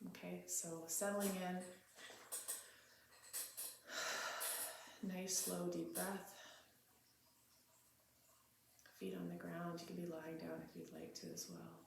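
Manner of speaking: quiet, spacious meditation leading, phrase by phrase; guided meditation. Real, not acted. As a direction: settle into a hushed, unhurried meditative prayer.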